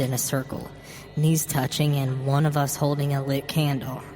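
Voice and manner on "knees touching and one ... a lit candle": usual speaking voice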